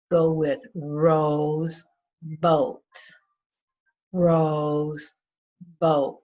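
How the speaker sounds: Rose boat said slowly, long O stretched; slow teacher modelling of Rose boat; slow phonics demo. Shown as a demonstration. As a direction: draw out the long O. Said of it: "We decided that float and goat".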